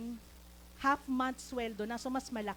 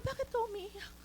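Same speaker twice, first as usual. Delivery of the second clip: much higher-pitched retold question; concerned question; 9 semitones higher, 3 dB quieter than usual. Speaker asking the crying friend why, high concerned voice. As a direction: ask it high, as if taken aback.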